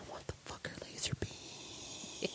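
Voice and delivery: hushed, breathy disbelief; a defeated little whisper. Acted. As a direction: whisper it in despair. Unvoiced whisper.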